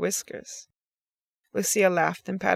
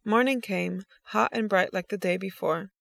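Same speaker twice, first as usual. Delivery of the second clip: plain reading voice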